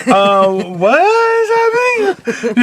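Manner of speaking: slow, high, baffled; drawn-out bewildered question; confused. Retold or imitated. Imitating her frozen confused self.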